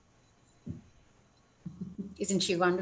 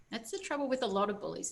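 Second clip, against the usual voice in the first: plain reading voice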